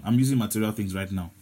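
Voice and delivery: ordinary delivery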